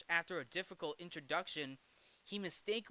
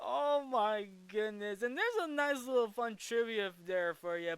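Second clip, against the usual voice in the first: amused; 6 semitones higher, 5 dB louder than usual. Real, not acted. Still amused after laughing.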